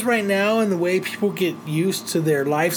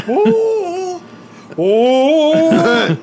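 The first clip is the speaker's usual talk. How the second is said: high, loud, sustained "ooh"; long hammed-up "ooooh"; mock shocked "ooh"; 11 semitones higher, 6 dB louder than usual. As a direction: hoot it in exaggerated shock. Acting out the shocked beer drop.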